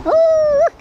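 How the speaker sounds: high, loud wind howl; howling wind imitation. Blizzard starting, mimicking wind.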